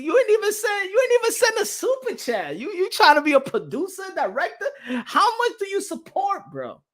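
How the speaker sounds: laughing at a viewer who never sent a super chat; laughing tease. Real, not acted. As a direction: rib the viewer while cracking up. Teasing chatter, laughing, pitch climbs.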